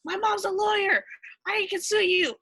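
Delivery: high-pitched mocking whine of privilege. Retelling a TV teammate's protest at police, mock entitled. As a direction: imitate an entitled kid threatening to sue.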